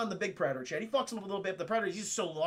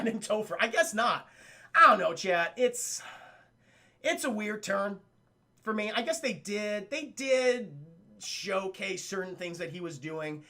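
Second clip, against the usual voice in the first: normal talking voice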